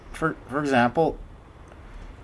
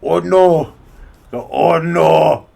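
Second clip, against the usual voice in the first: loud hammed-up Russian panic; exaggerated panic; 6 dB louder, much slower than usual. Tutorial shows an exaggerated line, loud mock panic. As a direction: ham up the terror, big and loud. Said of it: "something exaggerated".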